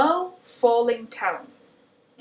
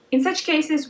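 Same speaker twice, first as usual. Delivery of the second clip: ordinary talk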